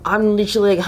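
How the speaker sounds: ordinary talk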